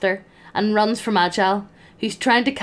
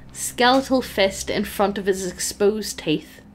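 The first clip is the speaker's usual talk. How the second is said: ordinary delivery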